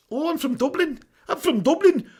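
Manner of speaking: put-on high-pitched comic Dublin accent. Streamer attempting a viewer's request, high Dublin accent. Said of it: "Dublin accent".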